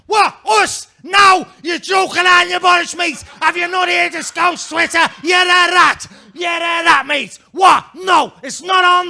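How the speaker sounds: loud, high-pitched outraged Scouse shouting; full-volume Scouse outrage, hammed up; screaming Scousers. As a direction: scream the protest like an indignant Liverpudlian. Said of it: "they practically screamed their way out of it".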